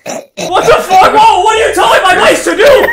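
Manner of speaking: loud, high, outraged disbelief; scandalized outburst; incredulous shouting. Real, not acted. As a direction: shout in comic shock. Outraged at what mice are taught.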